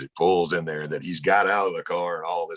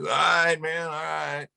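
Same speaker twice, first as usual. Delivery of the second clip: a lightly voiced, higher, loose buddy; buzzed Dave; 10 semitones higher than usual. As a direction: play the tipsy pal going along with it.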